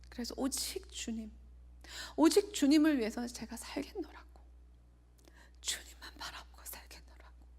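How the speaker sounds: whispered and tearful, voice giving way; holding back tears as she testifies; choked with tears. Real, not acted. Preacher telling the congregation her vow, near tears. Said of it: "아, 죄송합니다".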